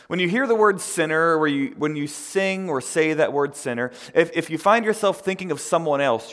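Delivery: loud, high-pitched admonishing preacher voice; full-force preaching. Real, not acted. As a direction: drive the point home to the congregation. Admonishes the congregation.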